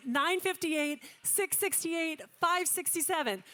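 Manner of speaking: rapid, rhythmic auction calling of paddle numbers; auctioneer calling. Acted. Auctioneer to gala room, calling paddles in rhythmic auction patter. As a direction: rattle off the bids like a live auctioneer.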